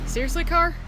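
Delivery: loud exasperated call at a car. Real, not acted. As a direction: throw it at the noisy car.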